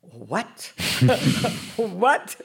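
shocked what. Retold or imitated. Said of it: "I'm going".

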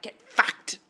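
voiceless, forceful mock retort; incredulous retort. Viewer reacting to an online film review, incredulous retort. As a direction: spit it back with hardly any voice.

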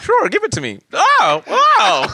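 high-pitched hammed-up amazement; naive delight. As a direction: gush like a skit character getting a present. Acts out comic album skit.

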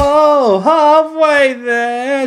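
high, loud, very slow mock echo; sarcastic sing-song. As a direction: stretch it out mockingly.

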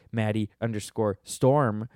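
ordinary delivery

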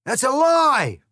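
very loud, very high shriek; outraged yell. Acted. The egg yells at Alice in outrage, very loud and high. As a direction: explode in outrage. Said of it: "he cried".

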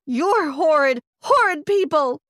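loud furious yell, rising high; angry yell. Acted. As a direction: yell it at the parents in disgust. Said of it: "I yelled at them".

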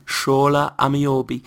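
slow, put-on Newcastle accent. Shown as a demonstration. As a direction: stretch the name out like a Geordie accent drill. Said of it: "That's how you get into a Newcastle accent".

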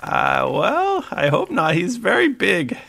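high, hesitant creature voice; Blurg Blurg. Acted. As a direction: pitch it up like a worried little captive. Game master answers as Blurg Blurg.

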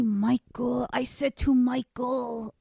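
put-on a light Israeli accent, slow; slow, lightly voiced Uri Geller in an Israeli accent; mild Israeli accent impression. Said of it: "That's a great Israeli accent".

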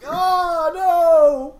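high, very loud drawn-out cry; hammed-up wail of dismay; mock yell. Acting out Rayquaza's rampage to co-host, hammed-up yell.

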